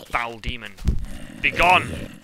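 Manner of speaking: hammed-up exorcist command, rising loud; mock banishing. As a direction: cast it out like a wizard. Banishing a mob in Minecraft.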